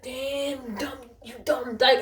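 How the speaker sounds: high-pitched imitation of a beaten man; mocking beaten man. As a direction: mimic him whining insults from the ground.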